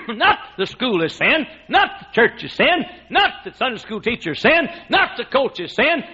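ordinary delivery

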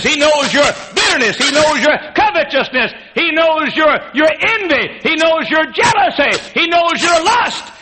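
high, loud list building to shouts; preaching at full force. Acted. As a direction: pound each phrase harder.

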